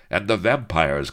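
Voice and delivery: plain reading voice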